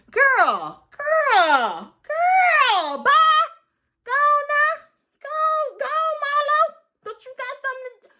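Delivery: high, loud cheering yells; hype shout. Real, not acted. Vlogger screaming and cheering Marlo on.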